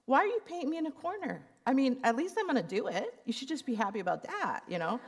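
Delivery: high, fast, exasperated protest; mock indignant. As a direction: replay the irritated pushback. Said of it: "I started getting mad at him".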